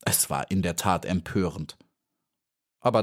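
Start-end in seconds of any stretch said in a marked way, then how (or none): none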